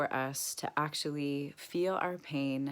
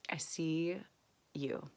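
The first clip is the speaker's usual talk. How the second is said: softer, tender words to herself by name; tender self-talk; 5 dB quieter than usual. Shown as a demonstration. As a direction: speak to yourself like a loving friend. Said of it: "Then I say to myself".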